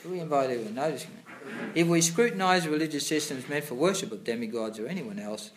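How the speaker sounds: loud, formal reading of scripture; reading aloud. Acted. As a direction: read the passage out like a sermon. Reads purport aloud louder.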